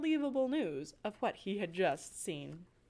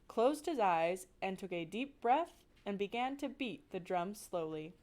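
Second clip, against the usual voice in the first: usual speaking voice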